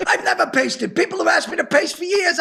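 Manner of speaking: high, incredulous comic protest; mock indignation. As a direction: protest loudly, baffled by the instruction. Acts out bafflement at computer instruction.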